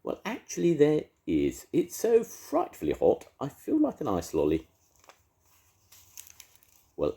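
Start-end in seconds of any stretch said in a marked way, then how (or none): 0.0-4.7 high, louder genteel beast voice; posh beast. A narrator's reading. The beast politely telling Edna he'd like an ice lolly, high and posh. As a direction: ask it like a frightfully polite prince.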